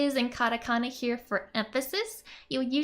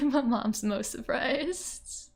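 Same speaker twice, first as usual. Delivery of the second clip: usual speaking voice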